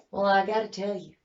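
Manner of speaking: ordinary talk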